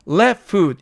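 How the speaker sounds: "left foot" as "렛 풋", with a brief rest; "left foot" with the ft swallowed; reduced pronunciation. Shown as a demonstration. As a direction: hold a short gap inside "left foot". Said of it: "이렇게 발음하는데".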